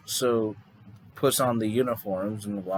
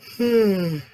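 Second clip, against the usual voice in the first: real yawn, high and falling; big tired yawn; 9 semitones higher, 6 dB louder than usual. Real, not acted. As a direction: let out a loud, long yawn. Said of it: "yeah, still yawning".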